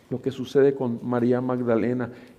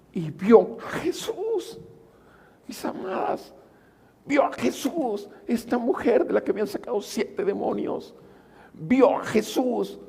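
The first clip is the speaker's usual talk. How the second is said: ecstatic preaching; 9 semitones higher than usual. Acted. Preacher telling the women she saw Jesus, much higher awed outcry. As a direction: lift it in wonder.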